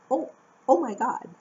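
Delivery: mock panic. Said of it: "they're always like".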